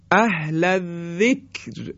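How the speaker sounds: اهل الذکر high and slow, thin final R; light R. Shown as a demonstration. (اهل الذکر کشیده با «ر» نازک)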